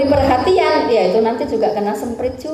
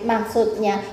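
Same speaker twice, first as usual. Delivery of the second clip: usual speaking voice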